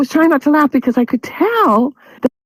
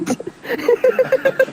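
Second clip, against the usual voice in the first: squealing helpless giggle; high wheezy laugh; 13 semitones higher, 4 dB quieter than usual. Real, not acted. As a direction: lose it completely. Host breaks up laughing.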